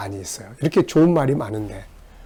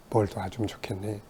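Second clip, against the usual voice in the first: gentle question; 4 semitones lower, 11 dB quieter than usual. Retold or imitated. The Lord gently asking.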